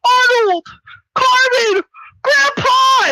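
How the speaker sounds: high, loud drawn-out name calls; mock anguished shouts. Hero calling to friends struck down, mock anguished shout. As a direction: wail each name like a melodramatic hero.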